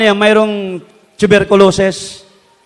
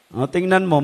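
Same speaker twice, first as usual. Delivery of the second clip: ordinary talk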